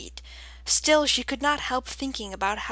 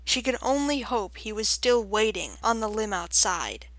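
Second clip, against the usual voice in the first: ordinary delivery, nothing marked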